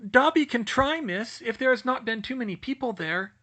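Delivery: high, eager little elf; house-elf voice. Acted. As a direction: squeak it out like a nervous elf.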